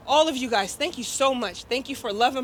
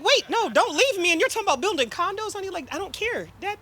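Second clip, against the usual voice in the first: retold bawling; 7 semitones higher than usual. Daughter begging her dying father not to leave, tearful and high. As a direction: replay the panic of begging him not to leave. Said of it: "I'm bawling".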